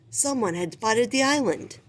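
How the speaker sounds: ordinary delivery, nothing marked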